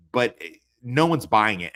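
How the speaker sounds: ordinary delivery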